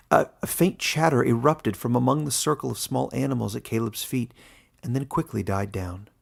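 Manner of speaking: plain reading voice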